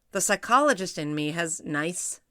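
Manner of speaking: ordinary delivery, nothing marked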